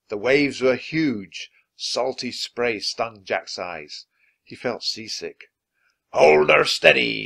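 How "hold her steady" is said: loud pirate shout. Acted. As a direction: shout over the storm. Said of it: "shouted Captain Bones".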